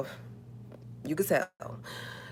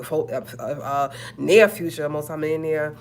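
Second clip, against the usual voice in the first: ordinary delivery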